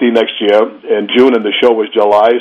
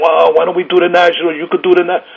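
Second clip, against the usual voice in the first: higher, coaxing wife voice; affectionate nagging; 6 semitones higher than usual. Retold or imitated. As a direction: wheedle him toward the Nationals. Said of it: "my wife ... started nagging me again".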